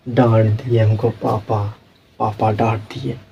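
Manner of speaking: low, soft, slow whimper; tearful sulk. Retold or imitated. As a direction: mumble it like a hurt child still sulking. Girl sulks after scolding. (धीमे, रुआँसे स्वर में शिकायत)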